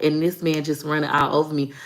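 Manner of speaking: ordinary delivery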